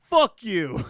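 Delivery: loud, high hammed-up retort; mock angry outburst. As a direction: fire back a comic insult at full volume. Acts out rude movie reply.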